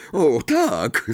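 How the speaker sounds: put-on cheerful assent; polite lie. A narrator's reading. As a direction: agree too eagerly. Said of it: "skłamali".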